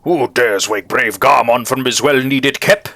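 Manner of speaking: high, loud theatrical cry slowing into grand outrage; outraged bug knight. Acted. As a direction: bellow like a pompous knight woken from sleep. Voices an outraged knight character.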